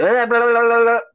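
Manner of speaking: loud, high burst of blah-la-la gibberish; mock your own stumble with rolling nonsense syllables; tongue-tied babble. Speaker tripping over a long word, loud high babble.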